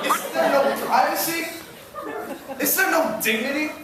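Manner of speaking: high-pitched indignant pleading; theatrical dismay, pitched well up; appalled lament. Acted. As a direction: plead it upward, scandalized by the crowd. Appalled thought at overheard phone calls.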